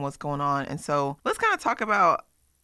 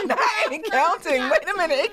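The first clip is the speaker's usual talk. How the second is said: laughing while talking; cracking up at the guest; 13 semitones higher than usual. Real, not acted.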